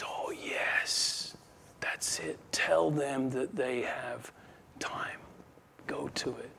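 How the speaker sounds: slow, conspiratorial whisper; head demon whispering. Acted. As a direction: whisper it as a scheming chief demon. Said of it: "the head one there says".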